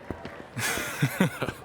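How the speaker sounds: soft airy chuckle, almost silent; breathy laugh. Acted. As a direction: laugh it off under your breath. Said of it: "sharing a laugh with the stranger".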